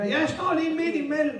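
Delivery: high, panicky inner voice; alarmed realisation. A narrator's reading. Peter realises he is between boat and Jesus, alarmed. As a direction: let the pitch leap as panic dawns.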